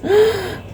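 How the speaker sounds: sharp storyteller's gasp of wonder; sudden high intake of breath, amazed. Acted. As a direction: gasp in acted amazement for the children. Storyteller amazed at quick climb.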